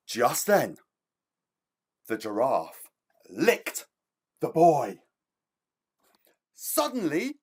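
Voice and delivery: lively storybook reading with big pitch swings; reading to children. A narrator's reading. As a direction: read it like story time for little ones. Author reads child's story aloud.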